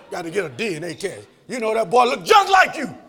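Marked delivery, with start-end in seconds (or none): 1.5-2.9 raised, shouted accusation; shouted insistence. Acted. Preacher confronting a father who doubts his son, shouting. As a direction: shout it at the denying dad.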